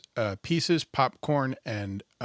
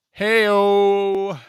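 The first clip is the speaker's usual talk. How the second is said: playful hey-o; 7 semitones higher, 8 dB louder than usual. Acted. Loud silly sign-off.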